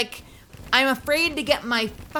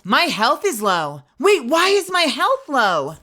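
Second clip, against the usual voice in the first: louder and higher, sudden worry; panicked question about health; sudden gamer alarm; alarmed realisation; 4 semitones higher, 6 dB louder than usual. Real, not acted. Notices low health.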